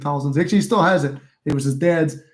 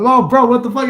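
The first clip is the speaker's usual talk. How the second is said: much higher, louder, disbelieving cry; shocked outburst; 8 semitones higher, 8 dB louder than usual. Real, not acted. Hearing a friend had the same gold car, a higher louder shocked cry. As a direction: blurt it out as the coincidence lands.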